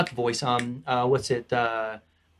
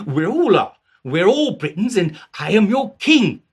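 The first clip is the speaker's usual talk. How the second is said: King Arthur; 4 semitones higher, 5 dB louder than usual. Acted. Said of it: "to King Arthur".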